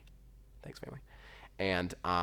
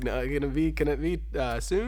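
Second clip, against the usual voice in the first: higher, louder, pleading for sooner; loud eager plea for it to happen soon; 9 semitones higher, 11 dB louder than usual. Retold or imitated. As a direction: beg him to hurry it up, half laughing. Pleading with the father.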